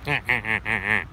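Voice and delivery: duck laugh in short, even bursts; evenly pulsed duck cackle; laughing duck call. Retold or imitated. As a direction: imitate a duck's laughing quack. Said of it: "he actually does sound like that".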